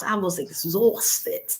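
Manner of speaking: heavy Bronx accent, very slow and drawn out; slow exaggerated Bronx delivery. Shown as a demonstration. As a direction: lean into a Bronx drawl. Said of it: "from the Bronx".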